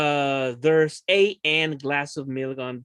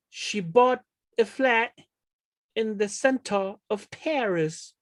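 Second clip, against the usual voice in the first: slow, higher, louder model sentence; 7 semitones higher, 4 dB louder, slower than usual. Shown as a demonstration. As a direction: spell out each word for the class. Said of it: "yo podría decir".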